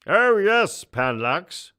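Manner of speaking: a booming, self-important ruler; pompous emperor. Acted. Emperor answers Panlax.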